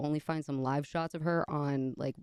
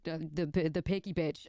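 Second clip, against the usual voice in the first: normal talking voice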